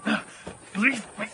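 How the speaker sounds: loud, high "leave me alone"; angry outburst. Acted. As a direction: push them off, furious. Man snaps at the vultures.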